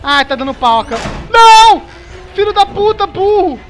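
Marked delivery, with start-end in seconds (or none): 1.3-3.6 loud, high terrified scream; scared scream. Real, not acted. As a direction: scream in real fright. Jump scare in horror game.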